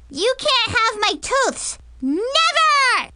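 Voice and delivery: put-on high, defiant little-girl yell; brave child shout. Little girl refuses the tooth fairy her teeth, shouting bravely. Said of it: "Brianna shouted bravely".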